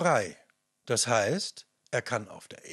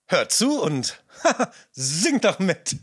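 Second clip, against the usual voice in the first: laughing; 5 semitones higher, 5 dB louder than usual. Real, not acted. Retake breaks into laughter.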